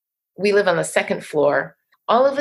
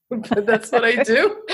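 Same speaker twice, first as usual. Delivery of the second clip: laughing; 5 semitones higher than usual. Real, not acted. Admits reciting to the ocean.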